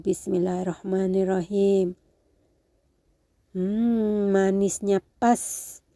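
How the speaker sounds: ordinary talk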